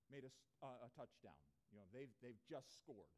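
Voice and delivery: ordinary delivery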